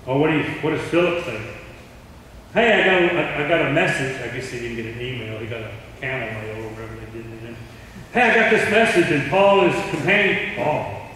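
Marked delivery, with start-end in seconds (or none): 2.5-4.2 higher and louder, calling out news; excited announcement. Acted. Philip voiced announcing message. 8.1-9.1 much higher and louder, calling out; excited call. Acted. Philip voiced announcing message.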